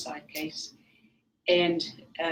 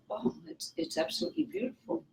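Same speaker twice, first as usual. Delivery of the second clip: hushed awe; 11 semitones higher, 8 dB quieter, faster than usual. Retold or imitated. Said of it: "and I thought".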